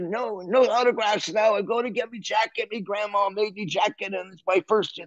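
high-pitched hurried character voice. Retold or imitated. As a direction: imitate a drummer brushing off fans in a tizzy. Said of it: "He said".

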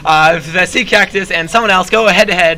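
loud, high sports announcer yell. Acted. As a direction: call the play like a hyped announcer. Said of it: "I'm going to do a good announcer voice".

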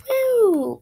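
high and loud whoop; celebratory woo. Real, not acted. Gamer cheering after finally passing the level, whooping.

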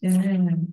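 long voiced "zzz" buzz; tongue to teeth, let it vibrate. Shown as a demonstration. Teacher showing students the third ending, buzzing z. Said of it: "khó ở cái chỗ phát âm".

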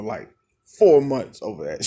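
usual speaking voice

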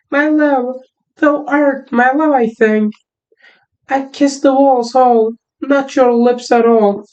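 put-on a boy camping up a high girly Thisbe; girl voice. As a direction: squeak out the lines like a lovesick girl. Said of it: "o kız sesini yapmaya çalışacağım".

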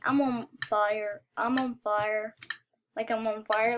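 low-pitched, level repeat; settled-down boast. Real, not acted. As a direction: drop it low after the excitement.

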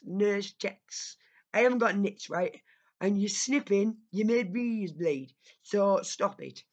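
loud, slow, emphatic telling-off; angry rant. Acted. Ranting at ASMR creator.

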